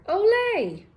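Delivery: high, loud cheering olé. Acted. Puddle jumping into the pond, high excited cheer. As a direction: shout it like a matador. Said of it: "he cheered".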